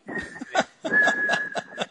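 very high thin whistle; imitated hearing-aid whistle. Son imitating his mother's hearing aid, high whistle. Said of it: "it whistles all the time. Like".

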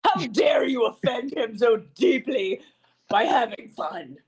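slow, hammed-up indignation; mock outrage. Host playing along with offended commenters, mock outrage. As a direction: scold with pretend offence, drawn out.

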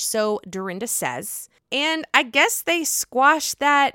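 ordinary talk, nothing marked

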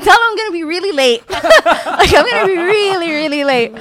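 high, loud words through laughter; laughing. Real, not acted. Storyteller amused at her own crash call, laughing loudly.

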